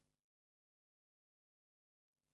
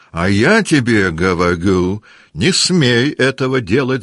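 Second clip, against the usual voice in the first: loud, high, slowed warning yell; shouting; 5 semitones higher, 7 dB louder than usual. Acted. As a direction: forbid him at full voice. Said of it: "закричал Денисов".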